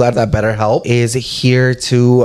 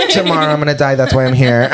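tipsy and giggling through words; giggly tipsy; 7 semitones higher than usual. Real, not acted. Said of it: "I'm a little tipsy".

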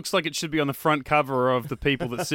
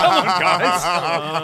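exasperated mock plea; 4 semitones higher, 5 dB louder, much slower than usual. Mock complaint at Stan.

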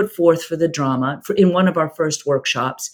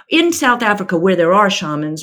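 ordinary delivery

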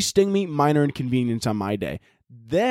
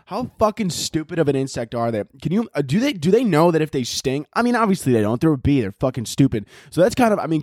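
ordinary talk, nothing marked

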